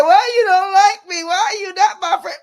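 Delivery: mock whiny crying. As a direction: whimper it like a rejected kid, mocking. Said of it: "I ain't gonna be like".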